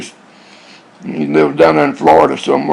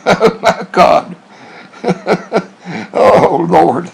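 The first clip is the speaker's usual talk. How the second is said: real chuckle breaking through the words; laughing; much slower than usual. Real, not acted. Man amused by mom and pop churches, laughing as he talks. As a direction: laugh helplessly as you exclaim.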